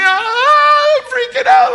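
high loud hammed-up panic; comic terrified wail; mock freaking out. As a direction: shriek it like you're curled up in fear. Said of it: "I just, like".

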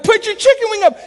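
high, loud shouts from behind the plate; shouted coaching cries; coach yelling. Retold or imitated. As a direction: bellow it like a Little League coach. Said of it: "I'm yelling all this stuff".

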